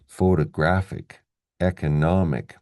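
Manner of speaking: "photographic, economic" stressed on "graph" and "nom"; second-last syllable stress. Shown as a demonstration. Teacher recaps ick endings, "photographic, economic" stressed second last. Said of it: "stress the second last syllable".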